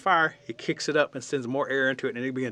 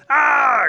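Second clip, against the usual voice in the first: long, loud, high acted scream; 13 semitones higher, 13 dB louder than usual. A narrator's reading. Raccoon finds his tail on fire, acted scream. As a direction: cry out like the burned raccoon. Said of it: "So he starts to scream".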